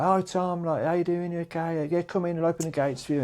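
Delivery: lightly voiced Yorkshire welcome; friendly Yorkshire gate guy. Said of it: "like, Yorkshire guys".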